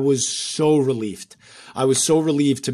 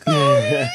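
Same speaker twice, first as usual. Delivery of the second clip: squealing laugh, much higher; 3 dB louder than usual. Real, not acted. Remembering the emotional round table, high squealing laugh. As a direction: let out a high giggle into yeah.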